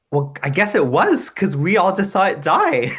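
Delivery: high, loud grudging admission; reluctant kids. Retold or imitated. Skeptical students conceding the shoe was alive, high grudging admission. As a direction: admit it as if caught out. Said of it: "they're all like".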